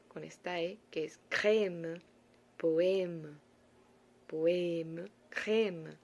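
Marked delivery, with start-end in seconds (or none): 1.3-6.0 "crème", "poème" with a wide-open E, mouth open as if smiling; E abierta. Shown as a demonstration. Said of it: "abrir la boca para que suene diferente". ("crème", "poème" con la E bien abierta, sonriendo y abriendo la boca)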